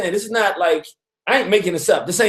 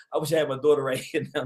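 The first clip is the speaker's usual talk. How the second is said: ordinary talk, nothing marked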